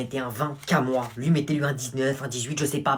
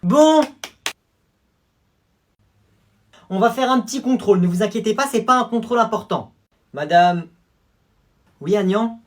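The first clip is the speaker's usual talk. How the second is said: high, bright-pitched teacher addressing class; higher, louder teacher voice; schoolteacher voice; 8 semitones higher, 7 dB louder than usual. Acted. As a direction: play the teacher announcing a test.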